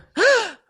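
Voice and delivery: sharp high gasp of mock shock. Imitating people reacting to the diagnosis with a shocked gasp. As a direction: gasp as if hearing awful news.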